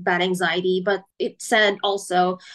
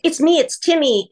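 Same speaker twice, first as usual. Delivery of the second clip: higher, louder imitated little boy; mock childlike voice, pitched up; 7 semitones higher, 6 dB louder than usual. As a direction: play a small boy announcing himself. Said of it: "turned into this almost like a little boy".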